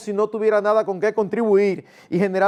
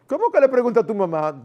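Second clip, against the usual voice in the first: dismissive father; 4 semitones higher, 3 dB louder, faster than usual. Acted. Father brushes off child.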